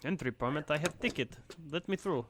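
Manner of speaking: mock Russian traveller, rising pitch; Russian accent. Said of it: "giving them all a Russian accent".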